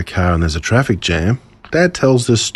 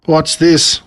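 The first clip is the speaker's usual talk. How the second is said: higher, sharp irritated question; irritated cry; 7 semitones higher than usual. A narrator's reading. Annoyed father crying out at a traffic jam. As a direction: call out at the stopped traffic. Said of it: "cried my dad as he peered ahead".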